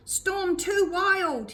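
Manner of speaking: slow, loud, high bellow. A narrator's reading. Fingerbone bellowing over the storm, slow and loud. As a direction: drag each word out against the gale. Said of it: "he bellowed".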